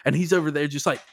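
ordinary talk, nothing marked

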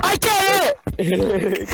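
loud, slow laughing exclaim; cracking up mid-sentence. Real, not acted. Laughing at the joke.

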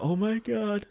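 sudden high-pitched shocked "oh my God"; mock stunned disbelief; a quick hammed-up moment of shock; shocked gasp. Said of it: "They both have a moment of".

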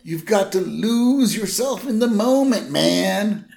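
high, mock laid-back teen; teen giving acting notes; laid-back Jimbo. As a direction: advise him coolly. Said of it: "Jimbo goes".

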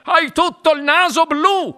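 high-pitched alarmed cry; shouting father. A narrator's reading. Father to daughter about her blue nose, shouting. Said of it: "gridò il signor Beauregard".

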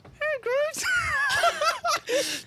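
very high squeaky dummy voice; ventriloquist voice. Retold or imitated. Recounting mirror practice while driving, high ventriloquist voice. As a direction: pitch it way up, lips held still. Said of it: "How good of a ventriloquist are you?".

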